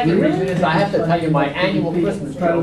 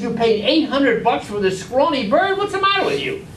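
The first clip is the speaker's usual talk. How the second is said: high indignant wife; wife scolding; 7 semitones higher than usual. Acted. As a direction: scold the husband in a raised voice. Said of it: "He says".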